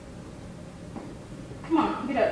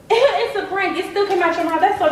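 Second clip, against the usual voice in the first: laughing; 5 semitones higher, 4 dB louder than usual. Real, not acted. Laughing at prank.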